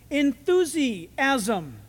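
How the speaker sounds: enthusiasm drawn out very slowly in separate parts; syllable by syllable. Shown as a demonstration. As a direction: break enthusiasm apart, stretching each part. Breaking word down to Greek root.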